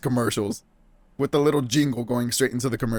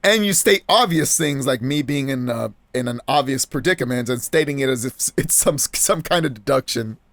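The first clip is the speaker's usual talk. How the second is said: ordinary talk, nothing marked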